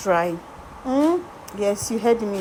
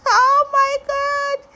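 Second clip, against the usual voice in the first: high, slow dismayed cry of surprise; oh no, she's crying; shocked gasp; 3 dB louder, slower than usual. Real, not acted. Makeup artist reacting to model's tears, shocked.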